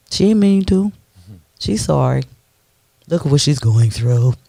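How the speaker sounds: mock sympathy. Mocking sympathizers' excuses.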